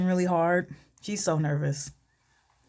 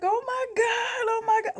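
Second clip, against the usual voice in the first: mock hysterics. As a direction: imitate someone losing it in tears. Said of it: "she's losing it".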